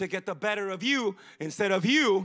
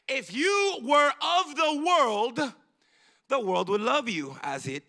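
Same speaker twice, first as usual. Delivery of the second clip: scripture reading; 3 semitones higher than usual. Acted.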